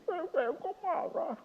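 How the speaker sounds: put-on slow, high, whiny little-kid voice; imitating a small child. Father retelling his little daughter sobbing, in a slow high child voice. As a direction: plead like a crying four-year-old. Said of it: "sambil berdiri".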